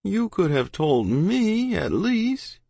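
slow, high, hurt reproach; a dejected boy's drawn-out complaint; down about it. A narrator's reading. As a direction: let down by a friend, dragging the words. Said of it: "He was pretty down about it".

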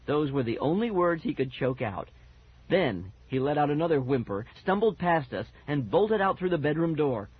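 ordinary delivery, nothing marked

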